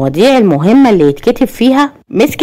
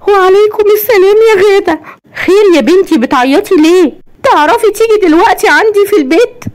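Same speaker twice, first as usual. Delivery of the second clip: high-pitched acted tearful voice; عياط; 11 semitones higher than usual. A narrator's reading. Crying cousin on phone. (صوت عالي بيعيط بتمثيل)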